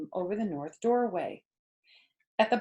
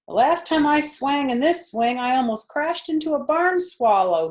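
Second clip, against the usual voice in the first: put-on higher, louder boy's yell; 7 semitones higher, 7 dB louder than usual. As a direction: yell across the barn. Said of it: "he yelled".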